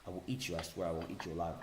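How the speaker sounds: quiet sinister threat; low menacing threat. Acted. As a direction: growl it softly, menacing.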